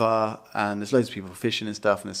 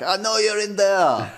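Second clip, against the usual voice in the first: put-on Dutch accent; 8 semitones higher than usual. Watchman at the van door, stern mock Dutch accent. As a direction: warn them off in a heavy Dutch accent. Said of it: "Why is this accent?".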